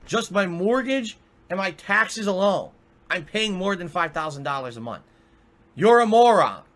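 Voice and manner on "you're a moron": loud insult, higher pitch; angry shout. Real, not acted. Streamer yelling at conspiracy theorists, loud angry insult. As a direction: yell it at them in anger.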